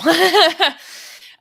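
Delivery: high, loud laugh of nerves; emotional laugh. Real, not acted. Host laughing off tears before reading her caption. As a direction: laugh to hold back tears. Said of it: "I'm actually gonna get emotional".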